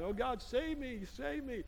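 high, soft pleading wail, lightly played; mock crying plea. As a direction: imitate a tearful altar plea, high and drawn out. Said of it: "I was down there crying".

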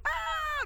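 sudden, very high mock scream of fright. Preacher showing how the disciples screamed, high mock scream. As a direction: shriek like someone jumping out at you. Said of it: "they were screaming".

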